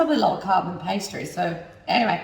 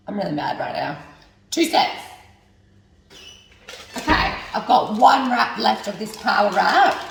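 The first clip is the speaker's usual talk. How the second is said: usual speaking voice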